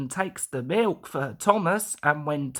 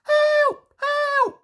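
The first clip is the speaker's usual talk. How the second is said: very high, loud cry for help; a runaway engine crying out; panicked whistle; 5 dB louder than usual. A narrator's reading. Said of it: "whistled Percy".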